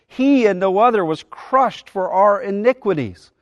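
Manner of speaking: emphatic "He and no other", deliberate, then fall to "for our iniquities"; stress on he and no other. Shown as a demonstration. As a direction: punch "He" and carry weight through "and no other". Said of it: "The, the he's here are emphatic".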